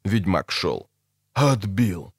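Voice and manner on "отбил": gasping in surprise. Acted. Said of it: "охнул Десятка".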